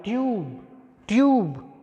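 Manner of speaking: ट्यूब with a y glide then a long u; model pronunciation. Shown as a demonstration. Teacher to students, modelling the long u. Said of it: "य और उसके बाद में बड़ा ऊ sound आ रही है".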